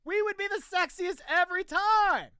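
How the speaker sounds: high, loud boastful declaration; emphatic shout. Real, not acted. Insisting Ohio would be sexiest, emphatic shout. Repeats his claim far louder.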